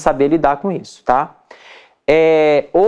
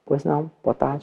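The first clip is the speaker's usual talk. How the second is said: quiet telemarketing voice; 3 semitones lower, 8 dB quieter than usual. Shown as a demonstration. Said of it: "O que que telemarketing faz".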